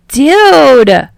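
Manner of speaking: loud, high indignant shout; outraged yell. Acted. Kaminari sees his phone crushed, yelling in outrage. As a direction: yell it in protest.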